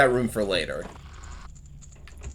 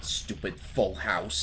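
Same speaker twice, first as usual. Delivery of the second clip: slow, softer cartoon-heavy impression; 2 semitones lower, 7 dB quieter, much slower than usual. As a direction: lightly put on a slow game-character grumble. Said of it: "then it's probably Heavy".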